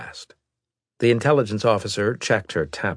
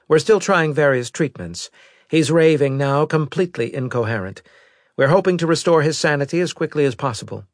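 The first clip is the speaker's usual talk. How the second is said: higher-pitched, louder officer's voice; intelligence officer; 9 semitones higher, 6 dB louder than usual. Acted. Officer briefing the admiral on a survivor, higher-pitched report voice. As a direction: deliver a formal report in a raised pitch.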